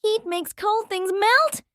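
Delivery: ordinary delivery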